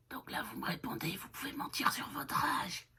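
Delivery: hushed playful note; whisper. Real, not acted. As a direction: whisper the joke to them. Whispered instruction to viewers.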